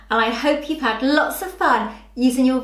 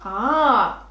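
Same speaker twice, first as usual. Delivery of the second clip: ordinary talk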